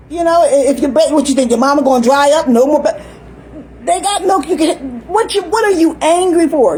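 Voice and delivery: ordinary talk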